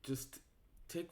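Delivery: usual speaking voice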